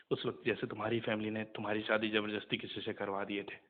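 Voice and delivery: ordinary delivery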